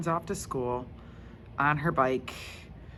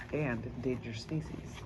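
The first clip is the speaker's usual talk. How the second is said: plain reading voice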